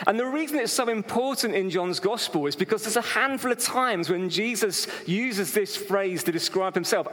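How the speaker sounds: ordinary delivery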